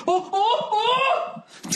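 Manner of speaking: high, squeaky "oh, oh, oh" ending in a laugh; hammed-up high yelp of disgust; mock disgusted cry. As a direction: act grossed out by brushing against filth. Acting disgust at dirty clothes.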